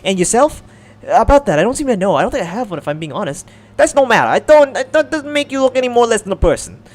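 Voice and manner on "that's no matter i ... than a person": put-on a light French accent, pitched higher. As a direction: speak like a gracious French lady. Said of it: "is she French?".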